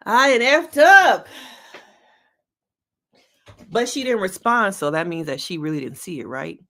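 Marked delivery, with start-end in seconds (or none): 0.1-1.3 louder, slow and higher; mock dismay. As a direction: wail it like you just got caught. Realizing mistake, mock.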